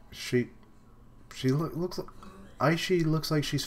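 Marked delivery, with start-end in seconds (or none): none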